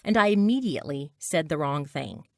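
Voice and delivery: ordinary delivery, nothing marked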